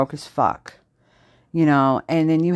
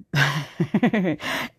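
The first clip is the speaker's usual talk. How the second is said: self-conscious chuckle; 4 dB quieter than usual. Real, not acted. Admitting a guilty texting habit, self-conscious chuckle. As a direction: laugh softly at your own guilt.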